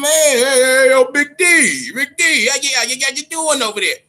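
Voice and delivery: high, loud mock hollering of Big D; calling out. Officials nervously phoning Deontay Wilder, high hammed-up call. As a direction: holler across the room to get his attention.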